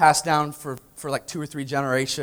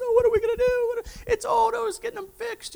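much higher, wailing mock sob; imitating mom crying; faster than usual. Mother grieving the heirloom, in a high wailing mock cry. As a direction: play the distraught mother wailing. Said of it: "My mom was crying inconsolably".

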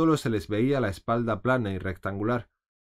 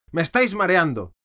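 much higher and louder shriek; 8 semitones higher, 7 dB louder than usual. A narrator's reading. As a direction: shriek at them to stop. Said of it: "chilló la reina". (chillido furioso, muy agudo y fuerte)

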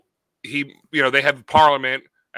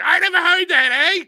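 very high-pitched wrestling character voice; hammed-up high mock accent; Dom from Top Rope Wrestling; 14 semitones higher, 4 dB louder than usual. Co-host turning a failed Canadian impression into a character, high voice. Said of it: "it's Dom from Top Rope Wrestling".

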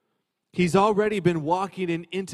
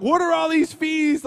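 exasperated; 11 semitones higher, 5 dB louder than usual. Retold or imitated. Frustrated at bank fees.

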